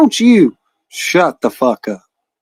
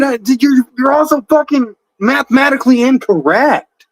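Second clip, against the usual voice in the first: high, incredulous ridicule; scoffing at a rival's counting slip; mocking disbelief; 6 semitones higher than usual. Real, not acted. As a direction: pitch it up in gleeful disbelief. Mocking rival's math blunder.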